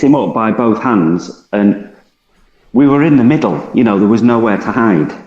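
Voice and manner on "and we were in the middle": ordinary delivery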